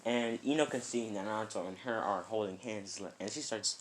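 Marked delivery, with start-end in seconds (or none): none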